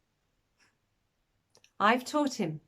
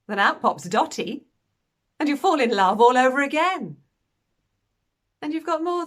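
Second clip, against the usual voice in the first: higher, louder rush of motherly joy; delighted gushing; 7 semitones higher, 8 dB louder than usual. Acted. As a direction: swell with delight. Mother recalling love for second child.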